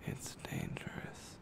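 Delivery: plain reading voice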